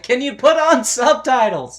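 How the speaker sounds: high, loud wisecrack; smart-ass heckle. Acted. Heckling the singers for subtitles, smart-ass. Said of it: "my smart-ass nature".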